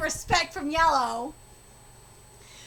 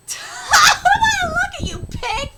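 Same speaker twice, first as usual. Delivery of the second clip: loud, high words through laughter; shrieking with laughter mid-sentence; laughing repeat; 8 semitones higher, 6 dB louder than usual. Real, not acted. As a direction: crack up while repeating the insult. Repeats the insult, cracking up.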